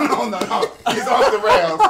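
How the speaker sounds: ordinary delivery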